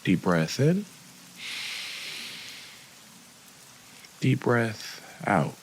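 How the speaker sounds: unhurried guide with long pauses between phrases; guided meditation. Acted. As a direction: lead them gently through each breath, softening as the body relaxes. Leads a breathing and body scan.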